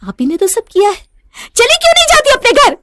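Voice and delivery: very high, loud screamed demand; furious. Acted. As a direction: explode at the aunt-in-law, rising to a scream. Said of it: "गुस्से में नैना". (ग़ुस्से में चीखकर)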